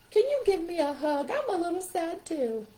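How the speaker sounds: high puppet voice asking for comfort; high-pitched, needy animal character; Softy. Shown as a demonstration. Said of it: "This is Softy".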